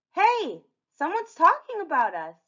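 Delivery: high, loud excited call; excited character. Acted. Red interrupting the narrator, excited.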